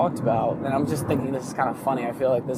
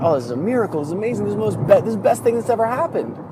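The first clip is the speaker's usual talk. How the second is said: excited townsfolk; 7 semitones higher, much faster than usual. Retold or imitated. Townsfolk celebrate the horses.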